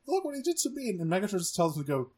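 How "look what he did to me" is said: high whiny complaint; whiny Thrust. Retold or imitated. Bandaged Thrust complains, high whiny voice. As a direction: whine like a hurt underling. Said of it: "I was like".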